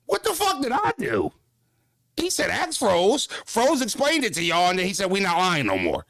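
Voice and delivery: high-pitched incredulous outburst; real disbelief, voice shooting way up; squeaky what-the-fuck exasperation; exasperated disbelief. Real, not acted. Exasperated at blame from fans.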